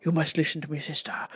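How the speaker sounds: hushed, low goblin whisper; sinister whispering goblin man; creepy goblin. Retold or imitated. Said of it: "creepy, scary goblin man".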